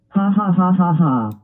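sneaky evil cackle; evil laugh. Acted. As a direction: cackle like a little villain. Villain plotting hiccup prank.

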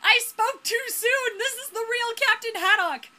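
loud, high-pitched disbelief; excited outburst. Real, not acted. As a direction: shout it like a thrilling discovery. Player reacts to discovering the character.

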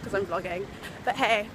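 ordinary talk, nothing marked

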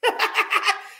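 high-pitched burst of giggles; laughing at self. Real, not acted. Streamer just botched an accent, high self-mocking laugh. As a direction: crack up at your own silliness.

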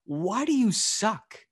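high, slow, drawn-out incredulous question; slow mock disbelief; mock exasperation. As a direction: act fed up with someone. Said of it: "It's just like".

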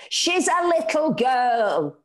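hammed-up shriek for her daughter; mock shriek. A narrator's reading. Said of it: "Anna shrieked".

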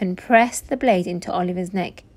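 usual speaking voice

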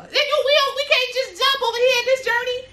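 squeaky, high mock of a careless plan. Mocking people who expect her to just jump, high squeaky voice.

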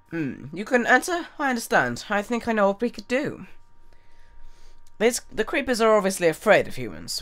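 quest giver voice. Acted. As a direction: read the villager's lines brightly. Voices quest NPC dialogue.

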